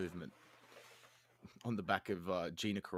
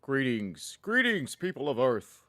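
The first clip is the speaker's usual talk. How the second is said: loud, high alien overlord greeting; booming arrogant alien overlord; 5 semitones higher, 6 dB louder, slower than usual. Pretend.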